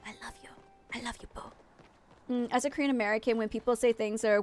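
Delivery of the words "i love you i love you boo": soft, breathy whisper to a loved one; affectionate whisper. Real, not acted. Telling Ali goodnight in case she's awake, whispered. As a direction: whisper it tenderly, barely audible. Says goodnight to sleepy child.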